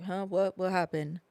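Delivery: soft, drowsy mumble; groggy just woke up. Retold or imitated. The nanny woken by cops banging, dazed and soft. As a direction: sound like you were just woken. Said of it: "she's like".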